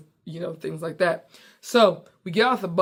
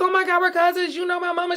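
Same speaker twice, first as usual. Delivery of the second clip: excited surprise; 12 semitones higher, 4 dB louder, faster than usual. Retold or imitated. Retelling discovering cousins on beach.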